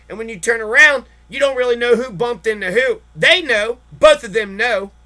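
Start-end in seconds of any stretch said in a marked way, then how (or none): none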